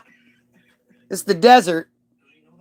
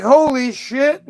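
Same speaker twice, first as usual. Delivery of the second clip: mock awe; 8 semitones higher, slower than usual. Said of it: "I'm not like".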